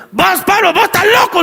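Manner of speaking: high-pitched yelled accusation; acted shout. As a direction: yell it like an exasperated governor. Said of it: "le grita a Festo".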